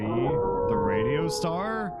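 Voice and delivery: high, slow, loud sing-song; announcer voice. Pretend. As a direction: belt it like a radio host. Reading a sub name.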